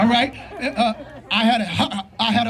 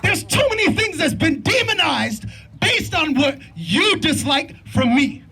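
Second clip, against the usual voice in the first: preaching at full force; 3 semitones higher than usual. Real, not acted. Father addressing rally crowd about pain and police reform, preaching. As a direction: preach it to the crowd, drawing out each line.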